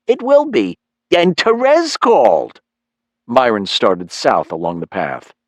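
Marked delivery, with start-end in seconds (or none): 0.1-2.6 high, stretched-out mom voice; pleased mother. Acted. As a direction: share news as a delighted, high-pitched mom. Mother with family news.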